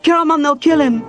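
very high, loud cry of terror; panicked scream. A narrator's reading. Cleric pinned down warns warrior, screaming in panic. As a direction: scream at him to stop.

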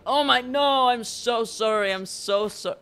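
high, loud panicked sorry; loud high-pitched panicked apologies; frantic apology. Real, not acted. Cracked egg on friend's head.

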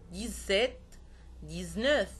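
ديزسيت and ديزنوف with a voiced z; French ديزسيت, said "deez-set". Shown as a demonstration. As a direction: buzz the X in ديزنوف as z. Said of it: "وبينطق زد كما في الأعداد".

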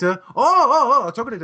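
put-on loud, high Korean-accented realization; hammed-up "oh, now I get it" in Korean accent; mock Korean accent. Clerk finally understanding the customer, mock Korean accent. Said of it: "English word with a racist accent".

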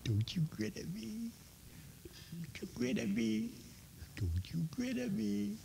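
high, soft sing-song teasing. Real, not acted. Preacher teasing a grinning baby in a high coo. Playful baby talk to the child.

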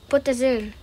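ordinary talk

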